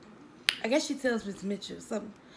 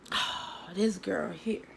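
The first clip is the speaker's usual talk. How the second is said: exasperated sigh; 2 semitones lower, 6 dB quieter, much slower than usual. Retold or imitated. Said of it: "But I'm like".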